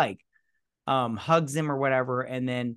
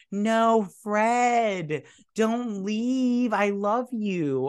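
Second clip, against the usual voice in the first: high, drawn-out mock wail; mock heartbroken plea; 8 semitones higher, 4 dB louder, much slower than usual. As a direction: beg melodramatically, high and loud. Acted viewer pleading with departing Fred.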